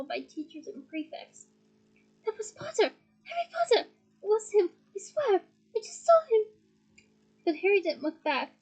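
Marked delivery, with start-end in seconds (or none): 2.2-6.5 high, thrilled exclaiming; excited student. Acted. Student tells friends about Harry, excited. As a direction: blurt it out amazed.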